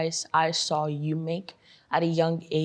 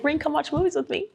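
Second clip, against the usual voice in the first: much higher, pleading mom voice; imitated mom; 12 semitones higher, faster than usual. Daughter mimicking mom's movie invitation, high and coaxing. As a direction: imitate mom coaxing you to join her. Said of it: "she's like".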